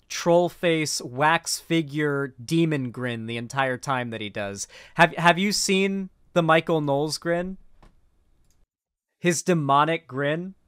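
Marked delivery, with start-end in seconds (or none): none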